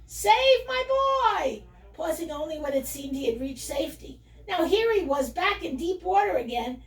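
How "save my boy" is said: high, loud, drawn-out desperate yell. Acted. Mother sow calling for help for her son, yelling. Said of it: "had continued throughout to yell".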